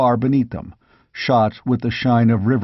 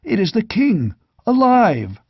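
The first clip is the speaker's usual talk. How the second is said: stunned recognition; 9 semitones higher than usual. A narrator's reading. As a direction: blurt it out in amazed joy. Knight recognizes the lost king.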